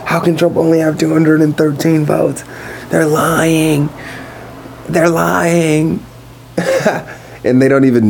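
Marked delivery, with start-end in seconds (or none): 0.0-6.0 higher, whiny voice of an upset Trump fan; mock upset voter. Imitating an upset voter who insists the count is rigged. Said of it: "There's somebody so upset. They're like". 6.6-7.1 sudden high-pitched laugh; high burst of laughter. Real, not acted. Podcaster laughing at his own impression, high laugh.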